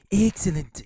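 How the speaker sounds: half-done cartoon villain voice; Mister Burns. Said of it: "doing Mister Burns voices".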